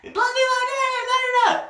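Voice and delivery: very high-pitched squawking jabber; mock angry rant. Girl rants at the poet, high mock fury. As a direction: imitate a girl ranting on and on.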